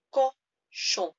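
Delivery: cochon high and loud, ending on a cold-like nasal on; nasal French cochon. Shown as a demonstration. Teacher exaggerating the nasal end of cochon for students. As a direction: hold the nose sound at the end of cochon. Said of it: "like you've got a cold".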